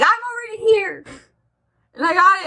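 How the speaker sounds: eager. Retold or imitated. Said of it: "And I'm like".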